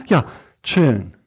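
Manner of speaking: ordinary talk, nothing marked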